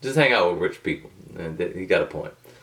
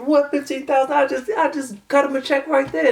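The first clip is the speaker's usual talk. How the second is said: much higher, startled retelling of his own reaction; mock shocked self. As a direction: act out your jaw dropping at the price. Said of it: "I said".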